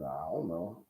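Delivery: low, very soft retold answer; sheepish admission. Young preacher questioned by his pastor, sheepish. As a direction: drop it down small and quiet.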